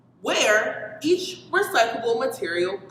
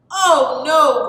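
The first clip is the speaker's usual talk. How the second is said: groan; 5 semitones higher, 9 dB louder than usual. A narrator's reading. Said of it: "groaned Austin".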